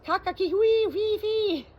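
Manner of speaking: high, loud, slow bird call; bird language. Acted. Parrot showing the doctor bird language, high chirps. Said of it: "But hear this".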